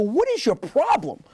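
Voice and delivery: high, slow exasperated imitation; mock exasperation. Husband to his crying wife, mock exasperated. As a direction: play the fed-up husband, stretched and high.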